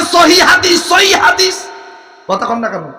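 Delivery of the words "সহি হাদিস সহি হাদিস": mocking quote. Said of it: "খালি কথায় কথায় বলে".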